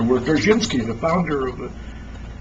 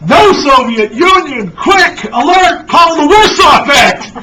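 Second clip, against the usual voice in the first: much higher, louder, slow alarmed shouting; panicked yell; 10 semitones higher, 10 dB louder, slower than usual. Acted. Astronaut to grandma, calling for the Warsaw Pact, panicked yell. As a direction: sound the alarm at full voice. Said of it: "The astronaut yells".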